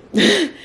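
short high laugh, shaking off the tears; embarrassed laugh through tears. Real, not acted. Laughing off tears on her vlog camera. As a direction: laugh it off so you don't cry. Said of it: "I don't wanna cry on this camera".

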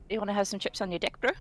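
New Zealand accent, fast and run together; fast Kiwi accent. Shown as a demonstration. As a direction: rattle it off quickly in a Kiwi accent. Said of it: "when you say it very quickly".

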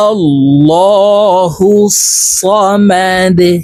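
slow, drawn-out recitation; recite along. Shown as a demonstration. Teacher recites for students to follow. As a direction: chant slowly for students to repeat. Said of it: "আপনারা আমার সঙ্গে বলুন".

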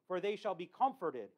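ordinary talk

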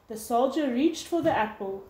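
ordinary delivery, nothing marked

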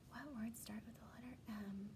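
hushed secret whisper. Acted. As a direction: whisper it to the class. Teacher whispers the question.

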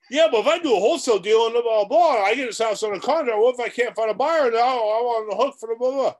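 high, loud, fast worried rambling; mock the nervous newbie's excuses; whiny objector. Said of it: "voicing a worried student's excuses".

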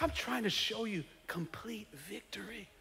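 whispered, unvoiced. Elisha urging Joash in a whisper. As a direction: say it in a hushed whisper. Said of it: "he's like".

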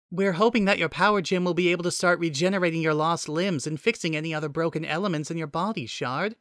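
high-pitched female AI assistant; Nicole the AI, explaining. Acted.

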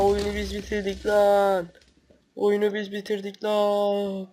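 drawn-out slow boasting line; slow gloat. Real, not acted. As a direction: savour every word of the win. Gloating after winning round.

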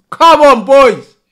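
loud cheering. Real, not acted. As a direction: urge the team on. Cheering team.

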